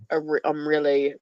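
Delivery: ordinary talk, nothing marked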